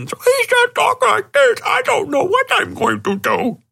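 exaggerated man-boy voice, very high and distorted; Hubie. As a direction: push a silly speech impediment way up high. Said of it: "amplified and run through distortion".

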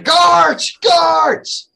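very high, loud cry for help; shouting. Acted. Jesse tackles an impostor and calls guards, shouting. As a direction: yell the alarm at full voice. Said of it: "as he starts shouting".

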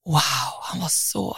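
wow whispered under the breath, swooning; breathy whisper. Real, not acted. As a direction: hush it like a secret crush. Viskar om hans utseende. (viskat förälskat wow)

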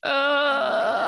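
a real choked-up catch in the voice; holding back tears; crying. Real, not acted. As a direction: overcome with emotion. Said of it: "I'm already crying".

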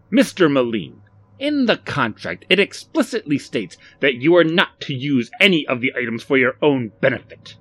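cutting declaration. A narrator's reading. Mister Contragust cites the contract to Barry, cutting and stern. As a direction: declare it so it cuts through the room. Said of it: "his voice cutting through the room".

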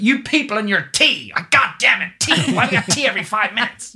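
mock American director, shrill-high and loud, exasperated; American accent. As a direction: ham up an American boss fed up with tea breaks. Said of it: "she'd be like".

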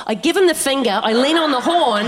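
ordinary delivery

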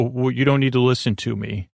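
ordinary talk

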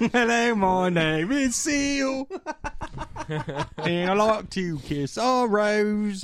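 high, slow mock Cockney [name] voice; hammed-up [name] voice, drawn out slowly; [name] impression. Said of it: "Was that your [name] impression?".

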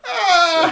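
high screaming gasp of horror; Donald Sutherland scream. Retold or imitated. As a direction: scream like the body snatcher. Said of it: "like Donald Sutherland at the end of".

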